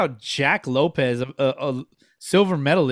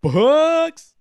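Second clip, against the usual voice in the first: mock impressed; 7 semitones higher, 6 dB louder, much slower than usual. Teasing over five bucks.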